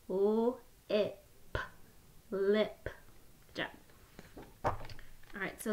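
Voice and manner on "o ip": each sound said separately with a short break; sounds tapped apart. Shown as a demonstration. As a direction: split the word into its sounds, one by one. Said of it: "Can you do that with me?".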